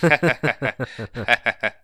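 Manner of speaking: imitated forced, awkward laugh; awkward creepy laugh. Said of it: "he's trying to do an awkward laugh".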